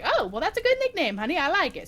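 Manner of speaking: high, loud mock Boston waitress; Boston accent. Waitress approving customer's nickname, high mock Boston accent. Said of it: "in her heavy Boston accent".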